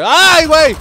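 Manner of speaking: high, loud startled cry; shocked loud exclamation; startled yelp. Real, not acted. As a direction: jump at the sudden scare. Sudden shock in game.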